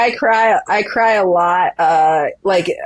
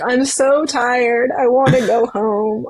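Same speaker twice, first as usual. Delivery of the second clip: slow, higher, whiny mock weeping; mock whimper; 6 semitones higher, slower than usual. Runner retelling how she cried on the course, slow mock whimper. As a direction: drag it out like a worn-out child. Crying from fatigue mid-race.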